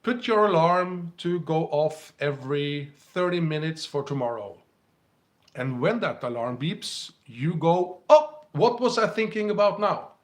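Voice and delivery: ordinary talk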